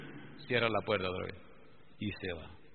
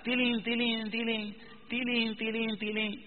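bell imitation; 10 semitones higher, slower than usual. Imitating little bells in story.

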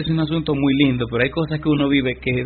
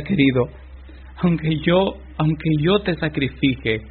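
ordinary talk, nothing marked

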